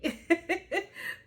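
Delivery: high, loud cackle; real laugh. Real, not acted. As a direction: burst out laughing at your own plea. Laughs after asking for prayers.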